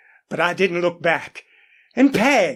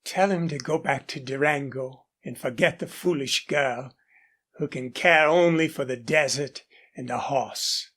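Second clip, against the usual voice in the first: softer, lower and faraway, as if gazing over the ranges; dreamy; 6 semitones lower, 5 dB quieter than usual. Acted. Daughter dismissing a suitor to her father, soft and dreamy. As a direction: let the words drift off dreamily. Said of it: "Lucy's eyes shaded dreamily".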